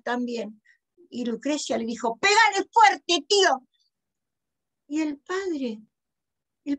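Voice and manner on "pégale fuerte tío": high, loud slow command; egging on. A narrator's reading. Lucrecia urging her uncle to hit the dog, high loud urging. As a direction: call it out loud, urging him on.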